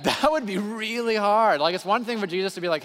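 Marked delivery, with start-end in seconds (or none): 0.0-1.6 loud, high, drawn-out complaint; mock protest. Preacher voicing reluctant believers to the church, mock protest. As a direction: stretch it out in exaggerated dismay.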